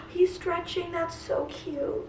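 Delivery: high, soft gasp of delight; high soft cooing at a stretching cat. Real, not acted. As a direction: melt over the cute cat, hushed. Watching cat stretch, gasping.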